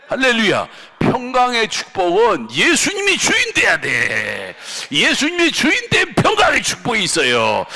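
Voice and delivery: high, loud blessing proclamation; fiery sermon proclamation; proclaiming shout. Real, not acted. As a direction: declare it over the congregation.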